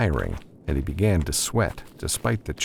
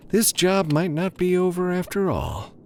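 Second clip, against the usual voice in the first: higher, lightly amused woman; Sharon's voice; 12 semitones higher than usual. A narrator's reading. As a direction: voice her, pleased with herself. Sharon's pleased line, much higher.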